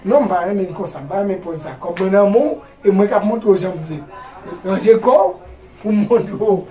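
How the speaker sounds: loud insisting. Acted. Acted argument over money and demonstration.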